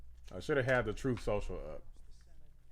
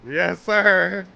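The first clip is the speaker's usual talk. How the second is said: higher, louder; hype shout; 7 semitones higher, 4 dB louder, much slower than usual. Real, not acted. Streamer cheering the winner for chat, triumphant shout. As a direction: bellow it in triumph. Celebrating the victory speech.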